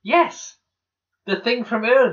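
high, loud burst of triumph; re-enacted delighted yes; excited recognition. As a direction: exclaim as the callback lands. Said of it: "I was like".

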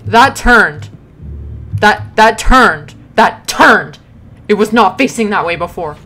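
loud, emphatic spooked protest; alarmed insistence. Real, not acted. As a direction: insist in alarm on what you saw. Alarmed at moved object in game.

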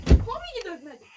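ordinary delivery

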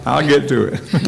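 light laugh in the voice. Real, not acted. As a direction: reassure them while chuckling. Mayor laughing at his confusion.

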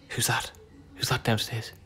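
hushed whisper to stir the dog up; whispered tease. Real, not acted. Owner teasing her pug about someone downstairs, hushed whisper.

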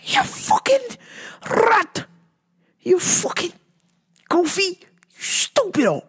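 high, strangled cursing at the app; furious outburst. Real, not acted. Venting at fake profiles, furious high cursing. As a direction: spit the insults out in rage. Ranting at straight men on apps.

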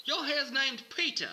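loud, high hammed-up Australian nature host; Australian accent. Nature show host greets the Critter Whisperer, in a hammed-up Australian accent. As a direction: bellow it like a cheesy Aussie wildlife presenter. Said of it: "I'm about to have to do an Australian accent".

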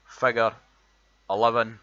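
lays every word out clearly, showing off the Edinburgh sound; Edinburgh accent word list. Shown as a demonstration. Reading a word list to show his Edinburgh accent, loud and deliberate. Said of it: "the words that we've got".

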